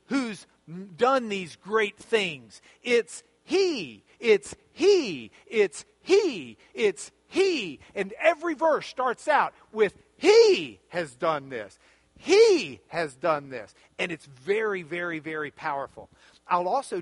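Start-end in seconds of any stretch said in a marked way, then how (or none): 2.8-7.8 each "he" punched and held; insistent, rhythmic stress on "he"; emphatic repetition. Real, not acted. Preacher hammering the repeated subject. 10.2-13.7 slow, booming emphasis on opening "He"; stress on HE. Shown as a demonstration. Every verse starts with stressed He.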